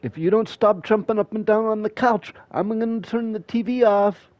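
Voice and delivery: high whiny threatening mom; nagging mom. Retold or imitated. Mom threatening kids over the TV, high nagging voice. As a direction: nag the kids with empty threats. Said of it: "She'd say".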